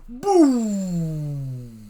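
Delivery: loud sound-effect boom; a big cartoon explosion boom. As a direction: blast the word out like an explosion. Narrator voices the explosion.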